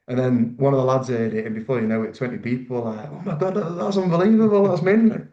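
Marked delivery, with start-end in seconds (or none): 3.1-5.3 much higher, thrilled group reaction; excited teammates. Retold or imitated. The lads hearing he's in the squad, excited high cheer. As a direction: mimic the lads going wild.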